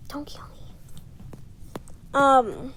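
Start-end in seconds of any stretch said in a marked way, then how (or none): 0.1-0.7 whispered aside. Real, not acted. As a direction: breathe it unvoiced. Aside pleading with audience.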